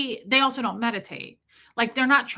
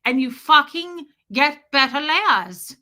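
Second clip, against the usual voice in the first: slow, loud, higher hammed-up bravado; brash coach voice; 5 semitones higher, 6 dB louder than usual. Streamer mimics Nancy's coaching line, loud and slow. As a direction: bark it out like a macho coach. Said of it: "she's like".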